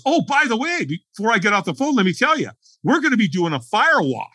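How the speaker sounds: high, lightly voiced buddy tossing it off; mock casual buddy. Buddy on the phone, casually dropping the fire walk news.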